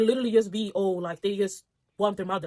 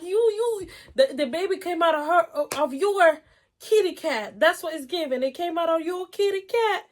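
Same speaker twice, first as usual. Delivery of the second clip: ordinary talk, nothing marked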